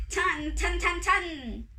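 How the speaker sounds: ringing imitation of drum booms; thunder drumming. A narrator's reading. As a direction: beat out thunder with the voice. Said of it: "and beat his thunder".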